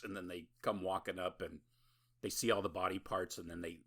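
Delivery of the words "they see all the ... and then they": normal talking voice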